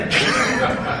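breathy pained laugh; soft unvoiced chuckle; quiet wheezy laugh. Real, not acted. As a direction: laugh that hurts the ribs. Laugh with sore ribs.

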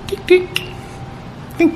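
light high tink, tink, very soft; tiny coin tinks. Retold or imitated. Preacher mimics the widow's tiny coins falling softly.

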